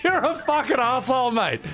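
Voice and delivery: high laughing yell; laughing outrage. Real, not acted.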